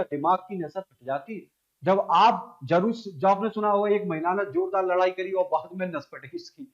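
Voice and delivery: ordinary delivery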